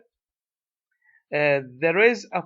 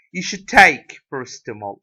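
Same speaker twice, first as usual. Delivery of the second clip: "take" punched louder than the other words; stress on take; 8 dB louder than usual. Shown as a demonstration. Teacher showing learners the stressed main verb, stress on "take". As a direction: lean hard on "take", letting the rest drop away. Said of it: "stressed as well".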